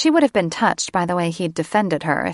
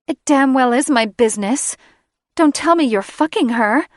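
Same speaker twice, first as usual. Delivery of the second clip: much higher, louder, rising fury; jealous outburst; 7 semitones higher, 6 dB louder than usual. Acted. Catherine confronting Drake about Sarah, furious and loud. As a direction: demand it, seething with jealousy.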